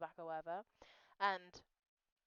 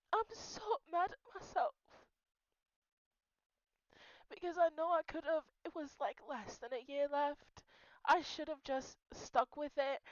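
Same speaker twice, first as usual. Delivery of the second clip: regret squeezed out through tears; real crying; 7 semitones higher, 3 dB louder than usual. Real, not acted. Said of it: "why am I crying".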